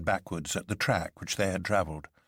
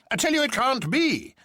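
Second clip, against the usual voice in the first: very high, loud insistent denial; crying out; 4 dB louder than usual. A narrator's reading. Colonel insisting to the men it cannot be, crying out very high. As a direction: stamp your foot and insist. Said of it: "cried the colonel, stamping".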